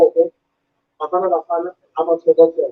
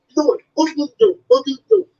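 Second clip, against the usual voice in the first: much higher, slurred imitation of a deaf fan's speech; imitated deaf fan; 8 semitones higher than usual. Fan praising the comedian's stuff, high slurred imitation. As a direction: gush like an eager fan who talks fast. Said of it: "They're like".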